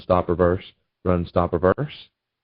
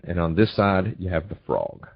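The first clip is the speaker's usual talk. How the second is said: normal talking voice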